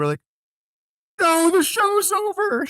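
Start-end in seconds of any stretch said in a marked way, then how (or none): 1.2-2.7 mock high voice. Retelling their own reaction.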